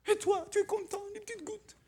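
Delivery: high-pitched, silly little voice; mock childish delight. Preacher teasing believers content with little drops, high mock-childish voice. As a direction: tease in a tiny high voice. Mocks believer happy with droplets.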